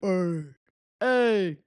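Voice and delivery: nasally big-dude ad-lib, imitated; [name]. Fan imitating a big rapper, nasally repeated ad-lib. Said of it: "he sounds nasally, and he's big".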